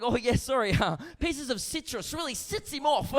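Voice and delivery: high-pitched apologetic Kiwi officer; New Zealand accent. Acted. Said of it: "Excuse the accent".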